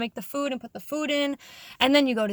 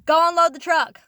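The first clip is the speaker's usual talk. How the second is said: imitated boss calling out, loud and high; mock-shouted command to rouse someone; barked order; 5 semitones higher, 9 dB louder than usual. Boss rouses sleeping workers to do chores, loud order.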